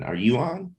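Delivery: usual speaking voice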